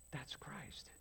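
soft whispered reveal; hushed awe. Real, not acted. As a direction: drop to a whisper on Christ. Whispered revelation.

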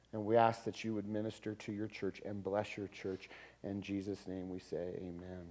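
soft, low prayer with pauses. Real, not acted. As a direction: pray quietly and unhurriedly, settling down into amen. Closing prayer before the sermon.

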